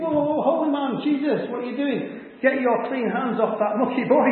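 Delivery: high-pitched, flustered protest; alarmed followers. Acted. Jesus' folks look on and try to stop him, alarmed.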